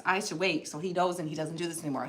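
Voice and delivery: usual speaking voice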